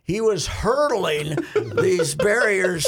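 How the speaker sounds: usual speaking voice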